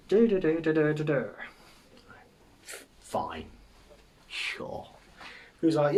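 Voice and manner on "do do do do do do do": loud, high nonsense syllables as mock Japanese; made-up language noises. Man claiming to speak Japanese, loud nonsense syllables. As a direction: rattle off any old noise as a language. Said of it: "He'd make any old noise".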